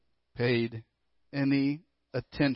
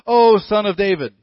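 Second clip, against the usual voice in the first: ringing cry of praise; loud acclaim; 5 semitones higher, 9 dB louder than usual. Retold or imitated. Crowd hailing Jesus with a loud cry. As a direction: call out to the king.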